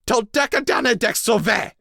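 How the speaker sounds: loud, high roaring shout; roared. A narrator's reading. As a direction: roar the words in fury. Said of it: "I roared".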